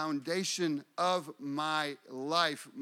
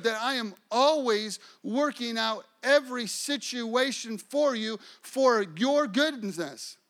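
higher-pitched, emphatic sermon delivery; emphatic preaching; 6 semitones higher than usual. Real, not acted.

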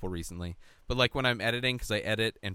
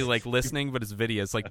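normal talking voice

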